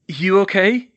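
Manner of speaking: out of breath; breathless worried question; panting. A narrator's reading. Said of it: "he panted".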